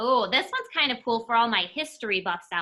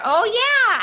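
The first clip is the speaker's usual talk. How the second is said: surprised realization; 11 semitones higher, 8 dB louder than usual. Real, not acted. Sudden realization.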